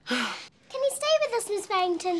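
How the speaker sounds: pleading. Acted. Child begging teacher.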